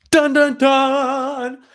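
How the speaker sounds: slow, high, drawn-out dramatic sting; mock suspense music cue; dramatic dun dun dun. Dramatic reveal sound cue.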